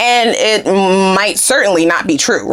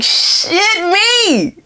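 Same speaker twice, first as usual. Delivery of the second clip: high, drawn-out mock dismay; mock exasperation; 11 semitones higher than usual. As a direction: groan it out high, falling at the end. Voices a date's exasperated reaction.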